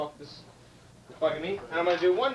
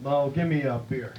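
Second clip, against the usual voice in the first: John Wayne; 4 semitones lower than usual. Acted. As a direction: order like a movie cowboy, low. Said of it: "He said".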